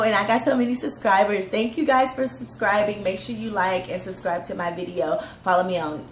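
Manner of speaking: ordinary delivery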